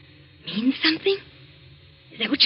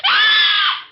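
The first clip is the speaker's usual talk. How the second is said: sharp high scream of terror; sudden shrill nightmare scream; terrified scream; 4 dB louder than usual. Acted. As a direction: scream as the figure reaches her. Nightmare climax.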